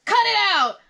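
high, loud irritated outburst; grouchy yelling. A narrator's reading. Grouch refuses to join the game, yelling. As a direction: shoo them off furiously. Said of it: "yelled Oscar".

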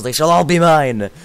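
playful mock-evil gloat; mock villain gloat. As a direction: claim the bottles like a cartoon villain.

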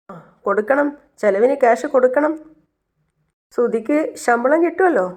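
high-pitched, insistent retort; pointed agreement. Acted. Acted reply about paying household costs.

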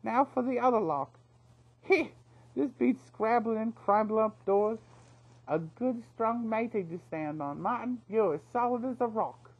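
high, loud chirpy boasting; cackling rascal enjoying himself; gleeful cheeky thief. A narrator's reading. Knopf on Martin's shoulders.

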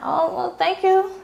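high, sweet mock-girly voice for "thank you"; high put-on voice thanking the stranger; her younger self flattered. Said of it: "I'm like".

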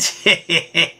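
short, soft chuckle. Real, not acted. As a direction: let out a little run of chuckles. Chuckles before signing off intro.

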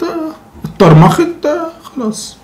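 high mocking drawl on اتطرمخت; sarcastic. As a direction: sneer at the fixed rules. Mocking the rule change.

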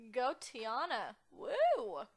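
loud, stretched cheer with a whoop. Real, not acted. Cheering on her game character.

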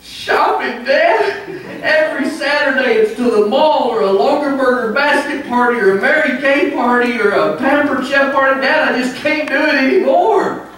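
high, hammed-up whining son; exasperated whine. Son telling his dad about the shopping, whining high. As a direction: whine the punchline like a fed-up young husband. Son's punchline complaint.

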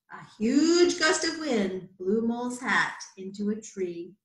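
ordinary delivery, nothing marked

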